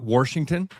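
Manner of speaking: "Washington" as "Warshington"; added R. Shown as a demonstration. Co-host giving example of adding letters, "Washington" with an R. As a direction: slip an R into "Washington". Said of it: "or adding letters".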